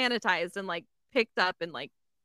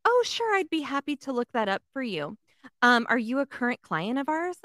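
high, sing-song fake-polite receptionist; mock sweet receptionist; 4 semitones higher than usual. Receptionist answering the phone, mimicked in a high fake-sweet voice. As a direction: put on a much higher sugary customer-service voice. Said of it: "she goes".

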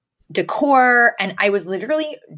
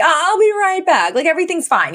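mock breezy cover-up; 11 semitones higher, 4 dB louder than usual. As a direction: pretend all is well, pitched way up. Voicing mother covering awkward moment.

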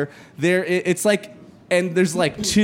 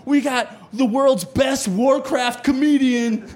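hyped event announcer; 8 semitones higher than usual. Retold or imitated. Host to the gaming crowd, booming hype announcer. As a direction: sell the comic like an arena announcer.

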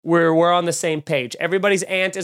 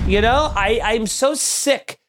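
usual speaking voice

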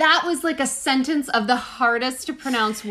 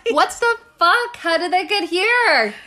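surprised; 7 semitones higher, 4 dB louder than usual. Real, not acted. Shocked at monkey origin news.

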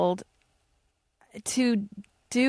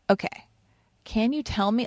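ordinary talk

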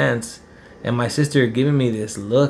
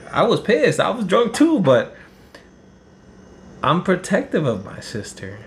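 heated; 7 semitones higher than usual. Real, not acted. Recalling the fight with his mom, voice rising in heat. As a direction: flare up as the anger returns. Heated rant about protecting sister.

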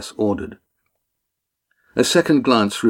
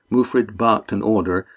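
ordinary delivery

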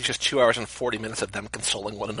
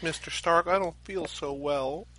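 faint and slow, fading away; weak, fading voice; 5 semitones higher, 8 dB quieter, slower than usual. Acted. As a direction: let the words drain out weakly. Quoting dying Peter Parker.

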